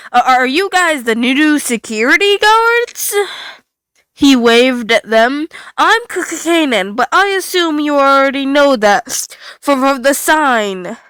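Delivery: cheerful broken robot greeting guests; glitching robot host. Acted. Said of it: "Kanan's happy tone".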